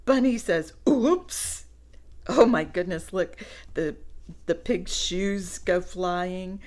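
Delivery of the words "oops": higher, louder playful oops; mock oops. Reading to children, bunny's surprised oops.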